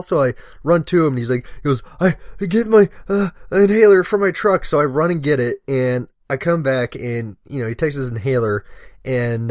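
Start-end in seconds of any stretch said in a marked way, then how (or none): 2.0-4.6 loud, high, struggling for breath; imitated asthma attack. Lieutenant asking for his inhaler, imitated breathless urgency. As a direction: act out gasping for an inhaler. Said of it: "a huge asthma attack".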